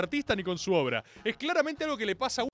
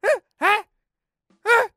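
mocking eh; 12 semitones higher, 9 dB louder, slower than usual. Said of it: "Me hacen".

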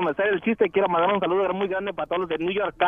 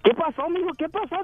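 worried father; 7 semitones higher than usual. Acted. Papá alarmado sale corriendo.

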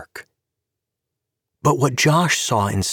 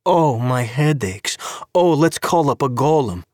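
mock his excuses in a taunting lilt; mocking sing-song; 6 semitones higher than usual. A narrator's reading. Tanya mocks Gabe's lies to his face: taunting sing-song. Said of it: "pitched into a mocking sing-song".

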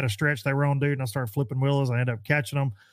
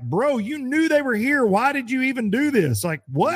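1 mock baffled protest; 11 semitones higher than usual. Retold confronting the pro.

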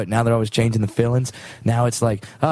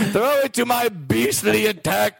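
royal decree; 6 semitones higher than usual. Acted. King's grand orders.

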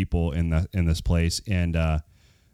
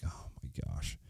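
breathy whisper, much softer; whispered disbelief; 5 semitones lower, 18 dB quieter than usual. Retold or imitated. Hearing the hour-long wait in line, whispered dismay. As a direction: let it out under your breath, stunned.

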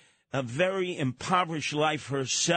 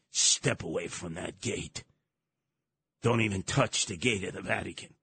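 stern guard; 7 semitones lower than usual. Retold or imitated. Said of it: "Swiss Guard ... saying".

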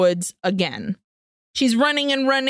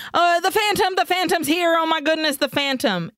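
frantic on the phone; 7 semitones higher than usual. Retold or imitated. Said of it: "and she's like".

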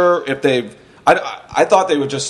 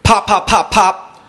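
quick popping gunshots, higher pitched; imitated gunfire; 5 semitones higher, 3 dB louder, slower than usual. Storyteller recalling shots heard on the street, imitated gunfire. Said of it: "All of a sudden I hear".

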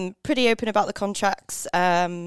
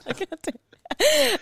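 high, slow laughing mimicry; high-pitched slow teasing parrot of a co-host; mocking echo; much slower than usual. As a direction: echo his line back in a high, slow, laughing mock whine.

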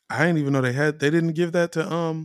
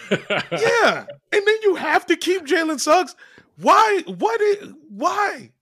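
high, loud incredulous yelling; outraged laughing; 4 dB louder, slower than usual. Real, not acted. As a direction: shout in laughing disbelief. Laughing outrage at keeper rules.

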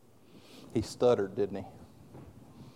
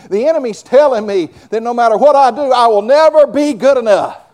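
starts level, grows louder line by line; building sermon litany; 4 semitones higher, 6 dB louder than usual. Acted. Preacher listing the enemy's lies, building louder. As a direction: stack each lie, climbing in force.

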